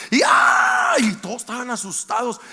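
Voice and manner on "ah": high loud mock scream; aunt's scream imitated in a story; imitated wail. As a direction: belt a shrill mock wail. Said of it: "gritando y llorando".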